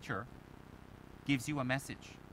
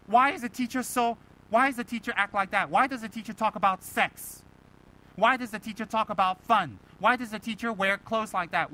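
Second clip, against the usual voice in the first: indignant complaint, pitched higher and louder; pissed off; 4 semitones higher, 5 dB louder than usual. Acted. Student grumbling about an unconventional teacher, pissed off. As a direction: rattle off aggrieved questions, each hit hard. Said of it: "you get pissed off".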